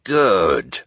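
gruff, loud put-on menace; fierce villain. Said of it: "trying to sound fierce and villainous".

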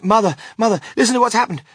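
put-on high, loud, breathless-with-news child; boy bursting with excitement, loud and insistent; excited cry. A narrator's reading. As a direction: gush the news and trip over the words. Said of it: "cried Charlie".

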